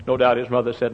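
ordinary talk

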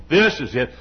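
low preacher voice, first word punched; Lee Robertson. Retold or imitated. Showing how an admired preacher spoke, deep and emphatic. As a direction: imitate a big man's deep pulpit voice. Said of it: "Always emphasized the first word".

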